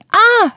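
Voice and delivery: high, sharp "Ah!" coming back from the mountains; echoing cry. A narrator's reading. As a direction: throw the yelp back like an echo. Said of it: "een stem vanuit de bergen die roept".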